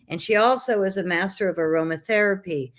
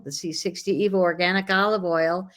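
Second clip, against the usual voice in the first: normal talking voice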